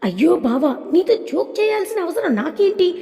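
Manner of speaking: narrated haughty retort, lifting high. A narrator's reading. Maithili denies joking to her bava, haughty. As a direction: brush him off with a touch of arrogance. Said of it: "పొగరుగా మాట్లాడుతూ".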